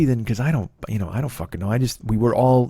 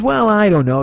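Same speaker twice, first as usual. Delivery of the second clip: higher, louder mom voice; imitating mom; 10 semitones higher, 6 dB louder than usual. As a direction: pitch it up loud as his stubborn mom.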